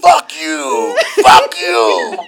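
high, loud drawn-out mock scream; Red's defiant yelling. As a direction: holler it back in a fight. Acting Red cursing back.

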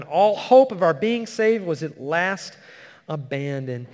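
panicked cry. Retold or imitated. To the congregation, a panicked sailor's loud despairing cry. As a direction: cry out like sailors giving up in the storm. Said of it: "they're freaking out".

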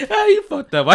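high voice cracked with laughter; laughing. Real, not acted. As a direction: laugh it out at the irony. Laughing at the dead kid.

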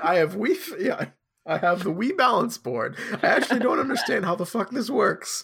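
put-on high, swooping old guy voice; kooky old Albus Dumbledore. Old wizard marvelling at a Muggle invention, kooky high old-man voice. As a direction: marvel like a dotty old wizard, pitched well up. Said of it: "an old guy voice for him".

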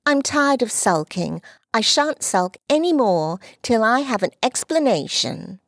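put-on a proud little girl who won't be put off; high young girl's voice, insistent; little girl Dulcie. Dulcie's lines.

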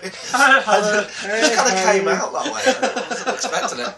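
talking through giggles; slow, high, laughing explanation. Real, not acted. Laughing after puppet voice.

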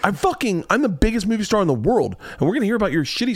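high-pitched, incredulous celebrity rant; indignant movie star. Retold or imitated. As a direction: act out a big star outraged at being upstaged. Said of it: "He's like".

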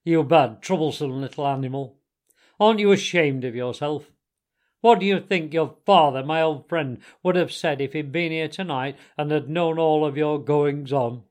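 loud, stern telling-off; forceful Badger rebuke; severe scolding. A narrator's reading. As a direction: shame him like a stern elder. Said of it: "he said severely".

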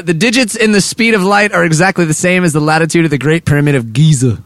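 ordinary delivery

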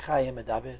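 ordinary delivery

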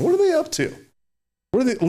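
jumps way up high in disbelief; incredulous outburst. Real, not acted. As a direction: squeal it out in shocked disbelief. Indignant rhetorical outburst.

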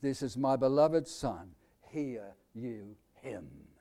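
quiet, solemn heavenly voice fading away. Acted. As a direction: intone it like God speaking from the cloud. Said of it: "his voice came from heaven".

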